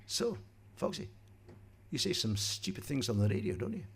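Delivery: a hint of a tough boss needling; lightly voiced boss, quietly mocking; Richard Park grilling. Tough boss Parky opens the job interview with a needling jab. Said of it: "and he went".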